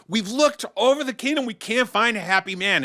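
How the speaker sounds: high, frustrated queen voice; exasperated queen. Acted. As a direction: she has searched everywhere and failed. Queen reports to wise man.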